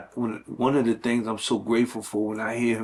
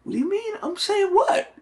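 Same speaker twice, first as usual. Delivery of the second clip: much higher, squeaky protest; defensive high yelp. Retold or imitated. Man protesting to his girlfriend, very high defensive squeak. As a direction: play it as a startled, innocent denial. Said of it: "You know".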